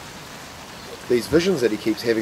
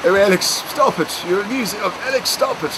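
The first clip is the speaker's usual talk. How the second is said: Pete's voice; 10 semitones higher than usual. Retold or imitated. Old actor Pete teasing Alex to stop, high and coy. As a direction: play the flattered old actor brushing off the joke. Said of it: "Pete had a sort of a voice like this".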